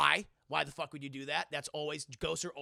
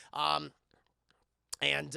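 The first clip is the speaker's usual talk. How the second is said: ordinary talk, nothing marked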